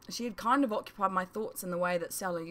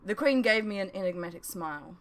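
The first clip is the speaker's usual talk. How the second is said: ordinary delivery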